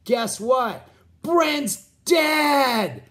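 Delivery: imitating a caller whose blurted news ends in a scream; voicemail message. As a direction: replay the voicemail, climbing until you scream the last words. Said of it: "the only message I hear is".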